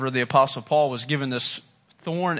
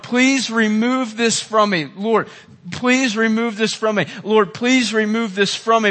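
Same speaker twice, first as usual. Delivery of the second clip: mild pleading; 6 semitones higher, 4 dB louder than usual. Retold or imitated. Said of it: "is pleading with the Lord".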